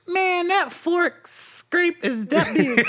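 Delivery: high, slow, laughing imitation of Brad; drawn out high mock of a friend. Teasing friend about his fork scrape, high slow laughing voice.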